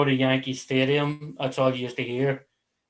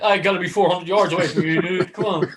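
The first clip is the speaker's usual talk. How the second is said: quick, high, raised voice; playful protest pitched up; mock pleading; 5 semitones higher, 4 dB louder than usual. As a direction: plead in a hurry, loud and jokey. Voicing orca pleading rule, laughing.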